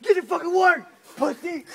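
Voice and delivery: loud snapped order; mock sergeant tirade; barked insult. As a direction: bark it at the lazy recruit. Barking at slacking worker.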